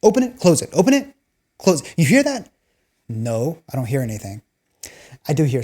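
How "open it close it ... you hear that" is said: mock commanding dad. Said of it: "I flip it to manual mode".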